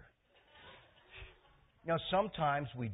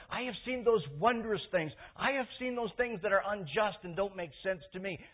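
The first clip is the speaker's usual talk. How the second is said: high, loud, emphatic preaching; preacher building to testimony; fervent preaching; 10 semitones higher, 7 dB louder than usual. Acted. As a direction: testify with full force. Preaching at full force.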